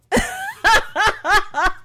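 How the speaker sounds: high, rhythmic cackle; real cackling laughter. Real, not acted. Host cracking up over the crazy daddy, high cackle. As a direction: burst out laughing.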